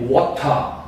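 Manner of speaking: "water" with a clearly released T; British hard T. Shown as a demonstration. Teacher contrasting British with American, crisp T in "water". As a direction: say "water" British-style, true T and no final R. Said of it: "Já o britânico fala o T".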